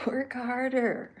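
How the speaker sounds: loud, slow, bossy order; brain's command. Retold or imitated. His own brain ordering him on, loud slow command. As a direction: bark it like a stern inner taskmaster.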